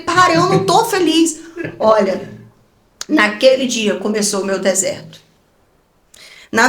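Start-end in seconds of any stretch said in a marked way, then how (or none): 0.0-1.4 protesto irritado. Retold or imitated. Bronca no marido ao telefone.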